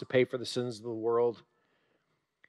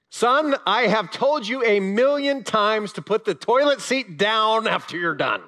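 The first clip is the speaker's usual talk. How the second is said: higher, louder nagging mom, slowing at the end; exasperated mother; 8 semitones higher, 6 dB louder than usual. Retold or imitated. Mother scolding her son about the toilet seat, loud and higher. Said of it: "Mothers, if you have sons".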